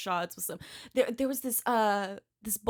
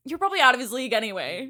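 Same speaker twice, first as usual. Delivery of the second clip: rushed, louder consoling line; quick reassurance; 3 semitones higher, 6 dB louder, much faster than usual. Retold or imitated. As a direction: rattle off the comfort fast. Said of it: "your date was like".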